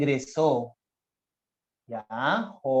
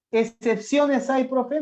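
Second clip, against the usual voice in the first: lightly voiced student, higher and slow; a hint of a puzzled pupil, pitched up; imagined student's question; 7 semitones higher, 3 dB louder, slower than usual. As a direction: pipe up like a pupil querying the teacher.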